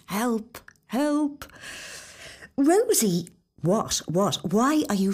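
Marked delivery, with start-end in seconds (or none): 0.1-1.4 mock panic. Horror at her reflection. 2.6-3.3 calling out. Acted. Nuala calling out.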